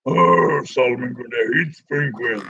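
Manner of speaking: a playful low monster take on the line; lumbering monster voice. Pretend. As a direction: say it like a hulking zombie brute. Voicing Grundy's line, lower.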